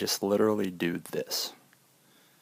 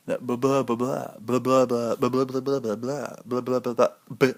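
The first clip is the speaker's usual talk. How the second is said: higher hammed-up YouTuber patter; mock YouTube voice; 3 semitones higher, 3 dB louder than usual. Shown as a demonstration. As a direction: parody the no-pause YouTube voice. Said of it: "I'm not gonna use that voice".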